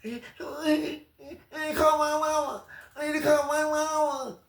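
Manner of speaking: put-on high, slow childish plea; high babyish whine; mock mama's boy. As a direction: whimper for mama like a grown kid. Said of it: "It's always mama".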